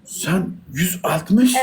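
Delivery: surprised. Real, not acted. Disbelief at her height.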